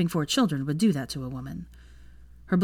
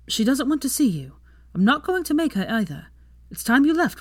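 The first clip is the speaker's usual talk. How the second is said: higher-pitched woman, standing her ground; firm mother; 8 semitones higher, 3 dB louder than usual. A narrator's reading. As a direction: protective mother showing him the door. Mother firmly sends away her ex.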